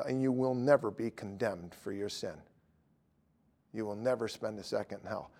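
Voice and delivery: usual speaking voice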